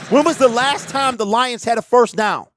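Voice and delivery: loud, high exasperated demand; exasperated fan. Real, not acted. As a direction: cry out in frustration at your team. Exasperated fan rant at game.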